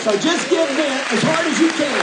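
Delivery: rally the congregation like a revival preacher; fired-up preaching. Acted. Pastor urging the congregation to clap and praise, fired-up.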